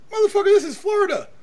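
high, loud mock-outraged yell; incredulous retort. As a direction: yell it way up high, acting exasperated. Retells his incredulous comeback in a quarrel.

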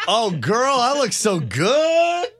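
high, slow, delighted gush. Real, not acted. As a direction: swoon over your own look. Admiring self in dress.

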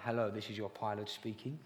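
low smooth captain's announcement; pilot voice. Retold or imitated. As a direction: put on a calm pilot intercom voice. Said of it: "a pilot voice".

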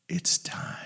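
whispered motherly nudge; breath-only, coaxing wake-up; gentle mother. Retold or imitated. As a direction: whisper it to someone half asleep. Gentle mother waking child.

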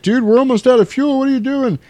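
high alarmed voice questioning the pilot; panicked buddy. Retold or imitated. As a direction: sound like a frantic co-pilot. Goose panicking about fuel.

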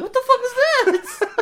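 high, loud, incredulous yell through laughter; laughing shout. Retold or imitated. Telling co-hosts how a listener reacted, laughing shout. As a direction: shout it slowly, high-pitched, while cracking up.

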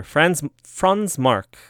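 slow mock German accent on Franz Marc; lightly put-on German accent, drawn out; hammed-up German accent, very slow. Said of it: "keep this German accent going".

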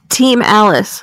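loud right into the mic; right in your ear. Real, not acted. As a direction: shout it straight into the ear. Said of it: "going right into your ears".

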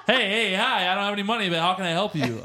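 mock an eager do-gooder accosting a stranger; hammed-up cheerful offer; overeager helper.

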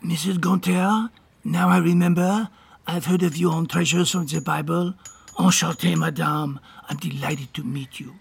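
put-on French accent. Francois introduces himself to Missus Gunther, French accent. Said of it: "your lovely French accent".

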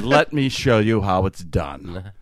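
slow, low mock-pompous magician; imitated haughty drawn-out boast. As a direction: show off grandly to the novice. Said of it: "real pompous".

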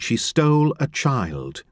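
plain reading voice

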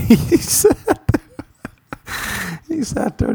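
high, wheezy laugh through the words; laughing while talking. Real, not acted. Comedian picturing his friend alone in the club, cracking up. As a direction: try to speak through helpless laughter.

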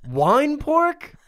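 very high, loud incredulous squeal; mock outrage. Accusing the food truck of wine pork, high squealing outrage. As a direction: shriek in disbelief, laughing through it. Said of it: "I'm like".

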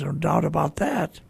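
ordinary talk, nothing marked

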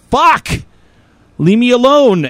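passerby annoyed at being stopped again, high and loud; exasperated outburst. Retold or imitated. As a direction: snap it out in annoyance. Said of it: "I'm like".

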